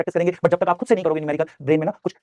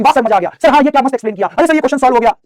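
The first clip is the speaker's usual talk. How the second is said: excited student praising the teacher, higher and louder; keen students in chat; 7 semitones higher, 6 dB louder than usual. Acted. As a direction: imitate delighted pupils, raised and energetic. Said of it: "पढ़ाई वाले question पूछते हैं".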